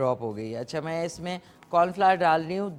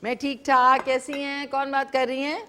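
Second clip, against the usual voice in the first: louder and higher, calling down a faint line; speaking up on the phone; 6 semitones higher, 4 dB louder than usual. Real, not acted. Host answering a faint live caller, louder and higher. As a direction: raise your voice so the caller can hear. ذرا اونچا بولیں.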